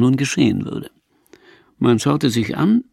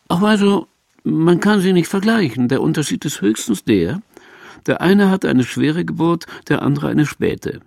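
put-on higher, animated old mother's voice; mother's retort; 6 semitones higher than usual. A narrator's reading. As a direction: voice the mother answering back, pitched up. Voices mother's sly answer.

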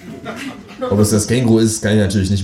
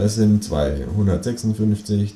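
ordinary delivery

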